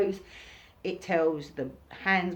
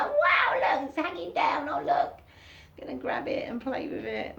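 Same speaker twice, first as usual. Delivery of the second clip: high, fascinated and eager; childlike wonder; 10 semitones higher than usual. Retold or imitated. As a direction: marvel at a spider like a curious kid. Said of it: "It's like".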